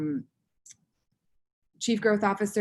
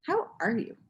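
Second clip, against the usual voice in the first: friend's pointed question; 5 semitones higher than usual. Retold or imitated. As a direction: ask it deliberately, seeing through the act. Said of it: "she just looked at me and she was like".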